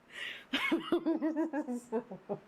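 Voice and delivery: high, long peal of giggles; helpless laughter. Real, not acted. Reader cracks up over the bad boob job line, helpless laughter. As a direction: crack up and can't stop.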